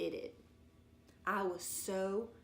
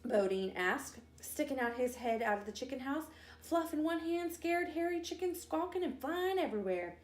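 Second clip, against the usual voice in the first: ordinary delivery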